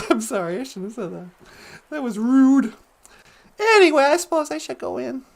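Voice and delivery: ordinary talk, nothing marked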